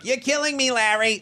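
high, whiny nasal American; nasal American accent. Acted. Host mocking his own accent, exaggerated nasal American. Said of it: "our American accent is so nasally that it just sounds".

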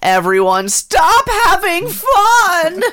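high, slow whining complaint; whiny Rabbit. Retold or imitated. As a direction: wail it like a fussy Rabbit. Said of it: "I'm fucking Rabbit".

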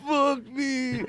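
high mock laugh on words; worst laugh imaginable. Comedian repeats his invented worst laugh, high and mock-wailing. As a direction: squeal it as a silly laugh. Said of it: "It's gotta start with".

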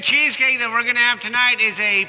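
put-on drunk captain announcement. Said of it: "somebody's had too much to drink tonight".

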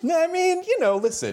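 high, hammed-up hedging tone; mock hedging voice. Joking about David's smell, high mock hedging voice. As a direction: hedge like a pompous spokesman.

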